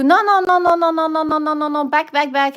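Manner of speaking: hammed-up panic, pulling back; mock panic. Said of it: "I'm playing stupid".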